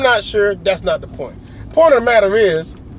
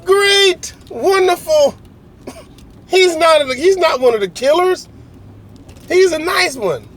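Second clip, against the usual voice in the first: high, louder, laughing relief; relieved laughing staff; 11 semitones higher, 4 dB louder than usual. Retold or imitated. Staff relieved he's a nice American, high-pitched laughing. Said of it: "they're like".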